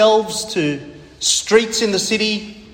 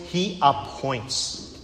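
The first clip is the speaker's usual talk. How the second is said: slowed right down and much softer; fading hushed repeat; 5 semitones lower, 9 dB quieter, much slower than usual. Acted. Preacher lets the repeat fade softly to his congregation. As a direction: let it die away, slow and soft.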